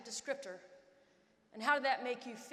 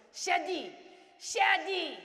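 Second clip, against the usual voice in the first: coach yelling; 5 semitones higher, 6 dB louder, much slower than usual. Retold or imitated. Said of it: "my coach yells at me".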